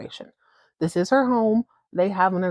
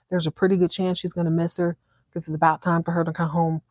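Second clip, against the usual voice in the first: normal talking voice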